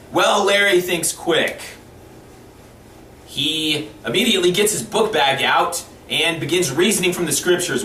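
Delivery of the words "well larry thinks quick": usual speaking voice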